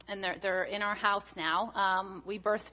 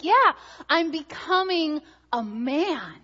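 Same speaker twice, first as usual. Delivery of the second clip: put-on slow, proud little-boy voice, chest puffed out; 7 semitones higher, 6 dB louder, slower than usual. Little son proudly telling Mommy he's grown up, slow proud child voice. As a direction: announce it like the biggest news in the world. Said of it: "he was thrilled about this whole idea".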